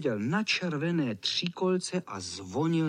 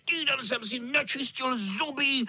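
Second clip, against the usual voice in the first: menacing tomcat; 9 semitones higher than usual. A narrator's reading. Nasty tomcat threatening the backing-away boys. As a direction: savor showing your claws.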